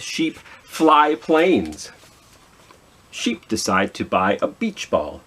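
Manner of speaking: ordinary delivery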